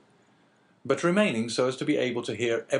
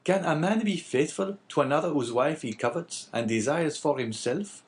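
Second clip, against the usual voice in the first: higher-pitched innkeeper character voice; lightly voiced Caderousse; 7 semitones higher than usual. Innkeeper answering the abbé, lightly voiced character. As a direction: lightly play the talkative innkeeper.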